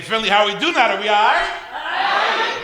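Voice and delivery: very high, full hype shout to the room; big crowd call. Real, not acted. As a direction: lift the whole room. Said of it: "final crowd call".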